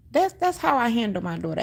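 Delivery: usual speaking voice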